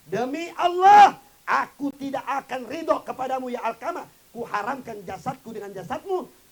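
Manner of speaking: loud, high, slow wail of a vow; mother's furious oath. Acted. Wounded mother cursing her son Alkamah, furious and slow. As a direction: hurl the curse at the son. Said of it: "Ibunya Alkamah berkata".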